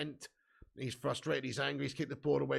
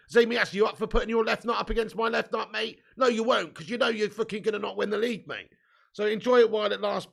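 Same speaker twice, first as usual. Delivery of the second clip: loud taunting bet; 6 semitones higher, 8 dB louder than usual. Real, not acted. Taunting rival fan about a bet.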